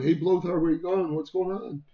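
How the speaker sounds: high-pitched calling-after voice; imitating song's voice. Person at song's end hailing Blothar, imitated high voice. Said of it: "the voice of the person basically going".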